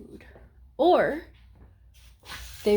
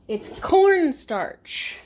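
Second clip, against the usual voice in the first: excited realization; 6 semitones higher, 4 dB louder, much slower than usual. Real, not acted. Sudden remembering.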